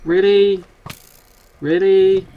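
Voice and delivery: high, loud disbelief; incredulous. Real, not acted. Player exasperated at a mob attacking him, incredulous. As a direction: throw up your hands, really.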